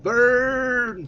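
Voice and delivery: Verne! shouted high, English style, like vurn; English pronunciation of Verne. Retold or imitated. Streamer mocks how the English said the name, high loud cry. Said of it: "C'est comme ça qu'ils disaient les Anglais".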